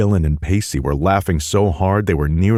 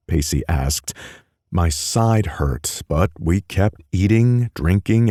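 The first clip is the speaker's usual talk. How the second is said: ordinary delivery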